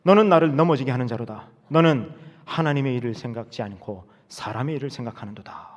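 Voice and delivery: loud stern rebuke, sharp on 사탄아; Jesus sternly rebuking Peter, loud. Acted. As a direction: call him Satan with full force. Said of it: "정색하면서".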